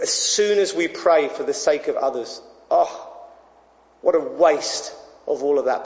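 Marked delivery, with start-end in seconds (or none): none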